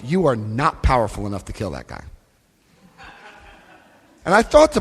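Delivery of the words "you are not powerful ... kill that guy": low, softer calm sponsor voice; a lightly voiced calm sponsor. Sponsor answering over the phone, low and calm. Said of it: "He goes".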